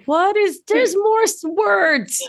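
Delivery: high, slow whiny mock voice; mocking complainer. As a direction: whine like a bored skeptic. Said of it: "for folks who are like".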